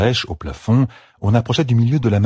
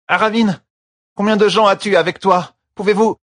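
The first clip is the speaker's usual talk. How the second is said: higher, louder urgent question; Fail calling; 14 semitones higher, 4 dB louder than usual. A narrator's reading. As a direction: call out over the debris. (appel pressant et plus fort)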